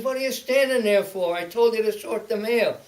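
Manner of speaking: high, loud, indignant demand; retold scolding. Mail clerk scolds the newcomer standing at the case, loudly. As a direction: tell off a subordinate loudly.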